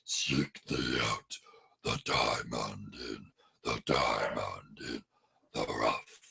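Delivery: low, slow echoing fade; fading cave whisper. Retold or imitated. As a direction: let the cave voice fade away.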